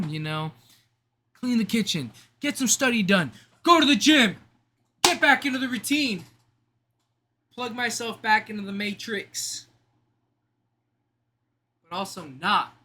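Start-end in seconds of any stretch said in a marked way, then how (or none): 1.4-9.7 higher, louder, pumped list; rally yourself through the plan; hyped up. Real, not acted. Hyping up his to-do list.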